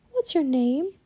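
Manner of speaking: put-on high, gentle girl's question; tender child voice; soft, gentle. A narrator's reading. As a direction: coax a timid creature. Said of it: "Annie said softly to the raven".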